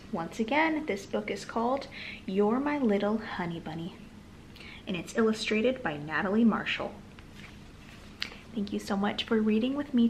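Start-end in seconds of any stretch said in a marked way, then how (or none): none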